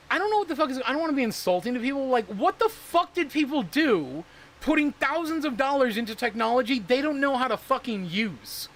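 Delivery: high, pressed, incredulous rant; frustrated rant. Real, not acted. Streamer ranting at viewers who misuse crypto, frustrated high voice. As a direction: let the frustration spill out.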